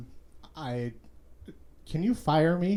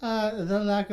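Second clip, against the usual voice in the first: a lightly voiced Irish character; Peeves accent; 3 semitones higher, 5 dB louder than usual. Peeves answers the host in a light brogue. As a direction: keep a hint of the Peeves brogue. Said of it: "Peeves accent".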